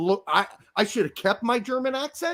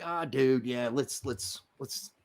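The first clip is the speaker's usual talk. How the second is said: mellow peacemaking; 8 semitones lower, slower than usual. Retold or imitated. Guy making up with his buddy hours after an argument, low and conciliatory. Cooled off, offers to make up.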